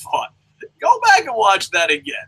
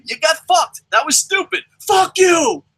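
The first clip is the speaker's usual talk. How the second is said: higher, louder snapped curse at fans; mock angry outburst; 7 semitones higher, 3 dB louder than usual. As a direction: hurl it back like a furious wrestler. Magnus voiced snapping at fans.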